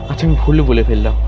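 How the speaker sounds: plain reading voice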